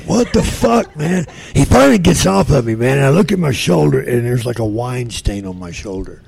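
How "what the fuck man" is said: much higher, louder incredulous outburst; exasperated. Retold or imitated. Pinned under a farting giant, high loud incredulous cry. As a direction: throw it out in disbelief. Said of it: "I'm like".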